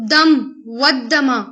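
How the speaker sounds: دم، ودما spoken slowly, syllable by syllable; slow Arabic recitation. Shown as a demonstration. Teacher to students, slowly sounding out دم، ودما. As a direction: give دم and ودما slowly for the class. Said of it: "دما مطلب خون".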